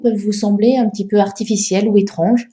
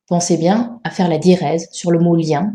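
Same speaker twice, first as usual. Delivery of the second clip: ordinary talk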